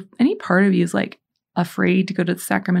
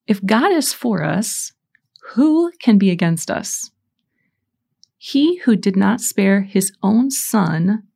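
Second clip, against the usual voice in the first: slow, emphatic Bible reading; scripture reading. Acted. Reading Romans eight to podcast listeners, slow proclamation. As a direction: proclaim it phrase by phrase, with weighty pauses.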